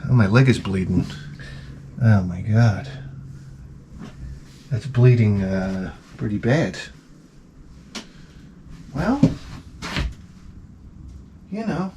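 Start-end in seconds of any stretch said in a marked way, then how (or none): 0.1-6.8 low, soft mocking of a calm girl; deadpan mimic of the girl, lower and softer; low, soft imitation of a calm TikTok girl. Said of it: "she goes".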